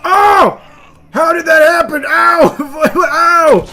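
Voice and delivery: loud, high yelps of shock and pain; real pained shout. Real, not acted. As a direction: shout out, caught off guard by the hit. Getting wounded mid-fight.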